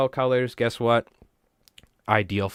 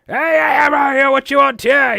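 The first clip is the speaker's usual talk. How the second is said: high, loud shout of a rowdy booth seller; yelling vendor; 10 dB louder than usual. Retold or imitated. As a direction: bellow at passing shoppers. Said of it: "He's, like, yelling".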